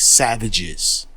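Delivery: usual speaking voice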